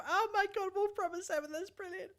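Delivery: thrilled, pitch way up; delighted. Real, not acted. Delighted by chat suggestion.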